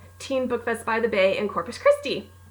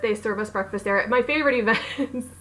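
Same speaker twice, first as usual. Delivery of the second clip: ordinary talk, nothing marked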